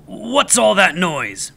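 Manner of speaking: roaring teacher. A narrator's reading. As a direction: bellow at the class. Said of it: "Mister Pratt roared".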